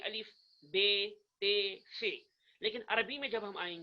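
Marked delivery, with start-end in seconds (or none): 0.0-2.1 "بے، تے" ending in a long ay vowel; Urdu letter names. Shown as a demonstration. Teacher contrasts Urdu with Arabic, Urdu letter pronunciation. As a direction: say "بے، تے، فے" slowly and loudly with Urdu ay endings. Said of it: "Urdu میں جب ہم پڑھتے ہیں".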